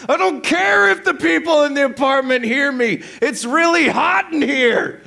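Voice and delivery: high, loud, defiant yell; acted screaming. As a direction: holler like an overheated, delirious husband. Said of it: "You are screaming".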